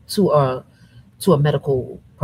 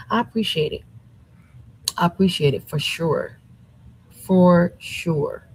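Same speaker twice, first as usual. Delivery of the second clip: ordinary talk, nothing marked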